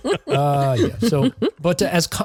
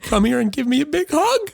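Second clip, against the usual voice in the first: very high, overcome imitation; hammed-up tearful plea for a hug; mock choked-up cry; 12 semitones higher than usual. Host retelling Ford greeting Kwan, mock choked-up cry.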